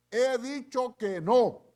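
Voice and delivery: loud, high, slow insistence; angry denial. Retold or imitated. Pedro losing patience with his accusers, angry loud denial. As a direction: slam the door on the question.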